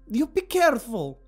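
high, loud female character scolding. A narrator's reading. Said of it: "Destiny scolded".

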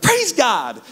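high, mock-pious "Praise God"; mock exclamation. Pastor imitating his friend losing the ring, high mock exclamation. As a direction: toss it up like a wry shout to heaven.

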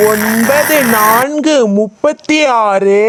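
very slow, drawn-out chant; slow sing-out of a times table; classroom recitation. Acted. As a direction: stretch each number out.

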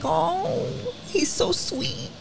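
soft, high, slow gush. Retold or imitated. Sister gushing over her crying brother, soft high coo.

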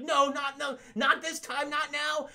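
high, pleading mock panic; acted panic. Spider-Man losing his powers mid-fight, acted high panic. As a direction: plead in hammed-up desperation.

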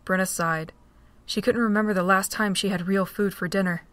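normal talking voice

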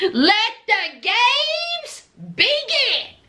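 hammed-up showdown announcer; mock announcer. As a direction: boom it like a ringside announcer.

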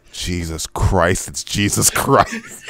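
slow quiet reveal. Retold or imitated. Justin reveals himself to the cashier, slow and hushed. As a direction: drop it low and slow as the glasses come off. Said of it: "taking off his glasses and going".

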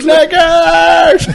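high, loud drawn-out yell; whooping long shout; diving-board shout. Pretend. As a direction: holler it from the top of a diving board. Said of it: "when you shout it from the top of the diving board".

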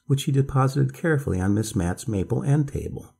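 ordinary delivery, nothing marked

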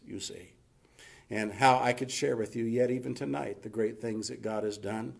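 usual speaking voice

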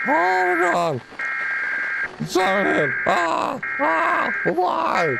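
high panicked wail; panicking gamer. Real, not acted. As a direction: shriek in despair at the noise. Siren Head screams again.

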